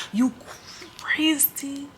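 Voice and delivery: sharp whispered jab; whispered aside. Real, not acted. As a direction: drop to a whisper on crazy. Ranting at the nurse.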